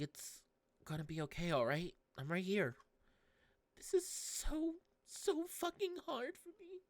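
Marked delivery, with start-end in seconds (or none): 3.8-6.8 put-on high, slow, distressed girl's voice; voicing Tsitsi. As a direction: play the despairing girl, voice pitched up.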